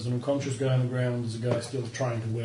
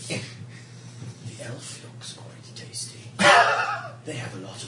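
dragon voice; 3 dB louder, much slower than usual. Acted.

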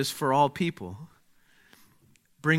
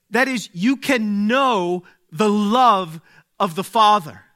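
raised, louder preaching voice, building; 8 semitones higher, 9 dB louder than usual. Acted. Preacher to congregation about joy, rising louder sermon voice. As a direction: proclaim it to the whole room.

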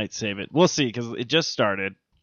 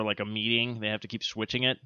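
normal talking voice

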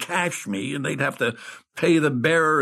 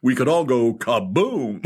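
ordinary talk, nothing marked

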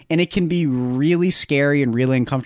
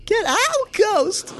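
very high falsetto mock preacher cry; comic imitation of the actor's ghost line, very high; mimicking Paul Dano; much slower than usual. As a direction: squeal it way up like a hammed-up preacher. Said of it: "Paul Dano".

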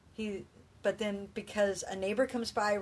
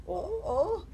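little whimper; 9 semitones higher than usual. Retold or imitated. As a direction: imitate a scared young man's little whimper. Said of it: "this little whimper noise".

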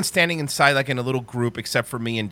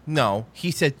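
ordinary talk

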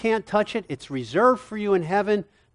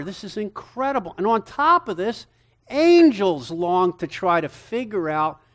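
ordinary delivery

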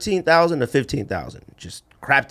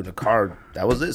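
ordinary talk, nothing marked

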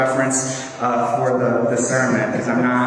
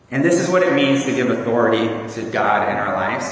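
normal talking voice

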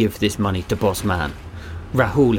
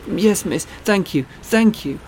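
put-on child voice; 10 semitones higher than usual. A narrator's reading. Boy voiced in story.

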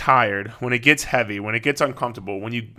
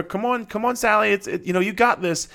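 high-pitched mock parent cheering on a kid; 9 semitones higher than usual. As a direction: imitate an overeager sideline parent, voice pitched way up. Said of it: "they get in there and they're like".

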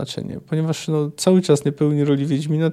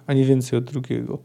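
ordinary talk, nothing marked

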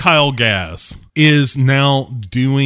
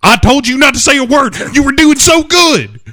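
high, loud, fast playful reprimand; mock scolding; 6 dB louder than usual. Host scolds cohost for interrupting, mock stern and loud. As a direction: scold the interrupting listener like a strict teacher.

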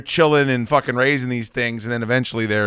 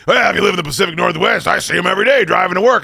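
higher, louder, mocking know-it-all commenter voice; smug one-upper; 7 semitones higher, 5 dB louder, faster than usual. Mocking a social media one-upper bragging about eagles, with a higher smug voice. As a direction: sneer out a smug internet brag. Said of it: "leaving comments like".

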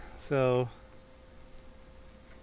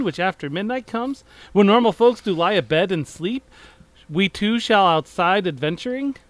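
grand, theatrical verse reading, higher and louder; mock Shakespearean reading; 4 semitones higher, 5 dB louder than usual. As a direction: declaim it like an old stage actor doing Shakespeare. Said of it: "In, in iambic pentameter, please".

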